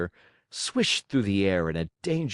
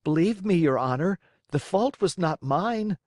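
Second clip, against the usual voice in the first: pleading puppet; 8 semitones higher than usual. A narrator's reading. Pinocchio pleading with director.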